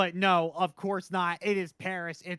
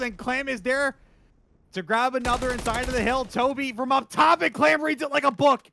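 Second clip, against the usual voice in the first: higher, louder build to a peak; excited play call; 6 semitones higher, 6 dB louder than usual. Real, not acted. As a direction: ride the play up to an excited shout. Calling a big play live.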